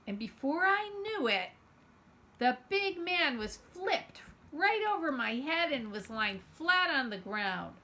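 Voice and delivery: ordinary delivery, nothing marked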